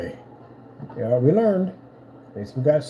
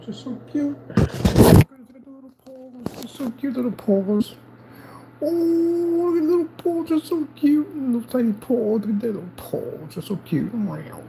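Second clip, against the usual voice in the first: sympathetic aww; 14 semitones higher than usual. Real, not acted. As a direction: coo over the tiny paws. Cooing over the cat's paws.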